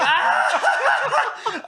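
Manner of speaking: high, loud yelps through laughter; cackle-screaming in the lobby; laughing scream. Retold or imitated. Teasing his brother in the lobby with laughing screams.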